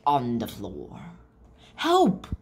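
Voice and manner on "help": high, loud cry for help; yelled. Acted. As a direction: yell it like a trapped witch. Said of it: "yelled the witch".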